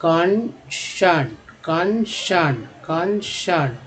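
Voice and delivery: कॉन्सर्न said slowly, syllable by syllable; teacher models कॉन्सर्न slowly; slow demo pronunciation. Shown as a demonstration. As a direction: draw out कॉन्सर्न for the learner. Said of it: "E N कॉन बोलते हैं तो".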